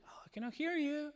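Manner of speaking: high, soft singsong dodge; mocking teen. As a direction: tease that you can't hear. Teen pretending not to hear.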